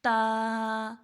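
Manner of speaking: تا with tongue tip at the upper teeth, held three beats; demonstrated ta. Shown as a demonstration. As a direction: stretch تا for three counts. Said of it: "کیسے ہوگا؟".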